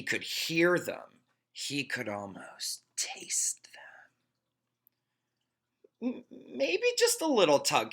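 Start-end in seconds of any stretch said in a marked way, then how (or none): 1.5-6.1 hushed, slow whisper. A narrator's reading. Narrator whispers the craving. 6.1-7.9 high, hesitant tempted voice; tempted stammer. A narrator's reading. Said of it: "he thought".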